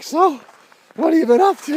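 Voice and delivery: mock cheerful question; chirpy friend. Friend asks, retold.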